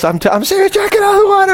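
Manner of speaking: high, frantic retold warning; mock urgent warning. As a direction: plead in a panicky high voice. Retells urgent warning to leave water.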